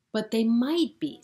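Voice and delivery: hopeful hedgehog pressing his point; eager insisting. Acted. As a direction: insist brightly.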